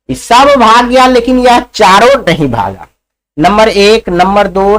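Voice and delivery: ordinary delivery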